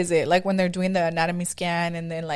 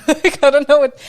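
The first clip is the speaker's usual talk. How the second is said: laughing; 8 semitones higher, 10 dB louder than usual. Real, not acted. Laughing while retelling the birth.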